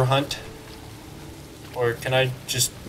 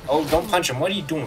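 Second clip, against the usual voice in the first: usual speaking voice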